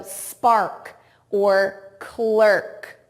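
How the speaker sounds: very slow, with pauses between example words; slow word modelling. Shown as a demonstration. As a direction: spell it out by ear, one unhurried word at a time. Teacher models example words slowly.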